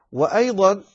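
ordinary delivery

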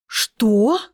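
high, gasping what; gasped. A narrator's reading. Stepmother gasps at the heroine's threat, shocked and high. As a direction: gasp it out in shock. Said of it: "ахнула Дорена".

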